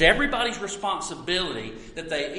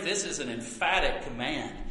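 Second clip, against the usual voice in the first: usual speaking voice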